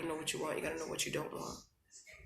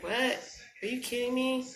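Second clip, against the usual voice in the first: mock disbelief; 7 semitones higher, 4 dB louder than usual. Her facial expression quote.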